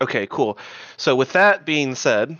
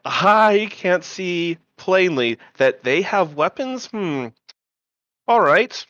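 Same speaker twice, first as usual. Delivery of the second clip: sarcastic boast; 5 semitones higher, slower than usual. Acted. Elf character sarcastically boasts in character.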